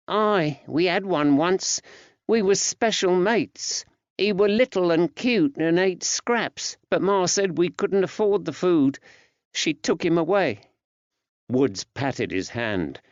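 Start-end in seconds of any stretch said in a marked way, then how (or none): 0.1-10.6 put-on high-pitched small boy voice; little lad remembering his lost dog; young lad Georgie, wistful. A narrator's reading. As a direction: voice a young boy, much higher.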